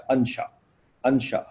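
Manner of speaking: انشا said an-sha, with a short a opening; zabar on alif. Shown as a demonstration. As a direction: open انشا clearly with "an". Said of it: "الف کے اوپر زبر لگا دیں گے". (انشا کو زبر کے ساتھ، اَن شا)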